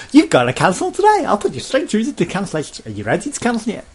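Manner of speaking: squeaky high, loud sales pitch; cheery pushy salesperson. Retold or imitated. Sky agent pushing cancellation, high cheery salesperson voice. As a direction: ham up an eager agent rushing him through.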